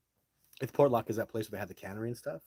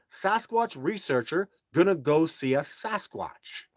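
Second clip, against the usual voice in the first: defiant reply; 7 semitones higher, 9 dB louder, slower than usual. Retold or imitated. His own reply in the acted scene.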